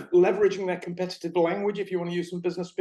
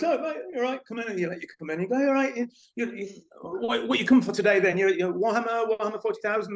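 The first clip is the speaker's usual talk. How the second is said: higher-pitched, chummy patter, stumbling over words; eager shop greeter; 5 semitones higher than usual. Retold or imitated. Shop worker welcomes someone wandering in, high-pitched chummy greeting. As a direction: imitate yourself welcoming a newcomer in a high voice. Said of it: "Muggins like me goes".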